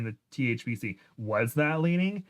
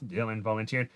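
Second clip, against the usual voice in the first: usual speaking voice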